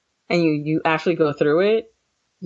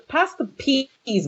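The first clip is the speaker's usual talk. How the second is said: slow, higher, lazy pass the peas; mock unbothered; 8 semitones higher, much slower than usual. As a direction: drawl pass the peas like nothing is happening. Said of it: "you guys would probably be like".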